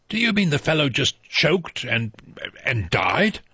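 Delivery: a hint of a gruff old soldier, stumbling in disbelief; old General MacArthur. General asking the doctor about the death, lightly voiced.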